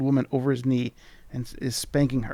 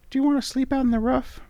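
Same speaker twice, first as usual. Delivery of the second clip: much higher, squeaky sprite voice; charming tiny creature; 12 semitones higher, 4 dB louder, faster than usual. Acted. Tiny creature charmingly greeting the traveller. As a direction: chirp it like a tiny fairy. Voicing the mini mite.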